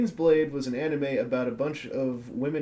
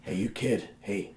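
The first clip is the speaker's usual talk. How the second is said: creepy stranger voice; 5 semitones lower than usual. Pretend. Creepy voice luring a kid.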